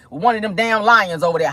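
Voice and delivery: normal talking voice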